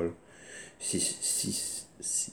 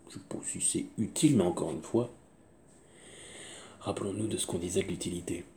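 normal talking voice

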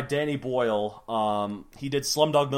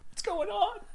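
frightened squeak; 6 dB quieter than usual. Acted. Donald frightened by voices.

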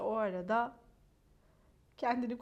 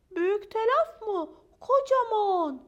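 high, loud, stretched-out boasting; dramatic exaggeration; 8 semitones higher, 8 dB louder than usual. Maya insists the splinter is huge, high dramatic exaggeration.

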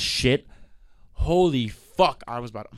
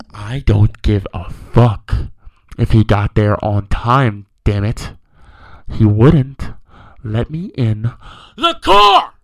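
slow, louder, biting out each word; retold furious outburst; 4 semitones lower, 7 dB louder, much slower than usual. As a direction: relive yelling at support, dragging it out. Retold shouting at Lyft support.

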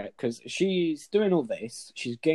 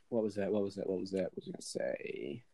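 low, soft mumble trailing into a near whisper; muttering to himself; 4 semitones lower, 9 dB quieter than usual. Real, not acted. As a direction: mutter it while groping for the lost question. Muttering to himself, lost thread.

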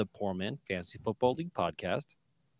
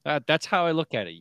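ordinary talk, nothing marked

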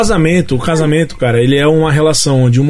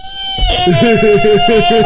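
much higher burst of laughter; real laughing shriek. Real, not acted. Bursts out at the husband story.

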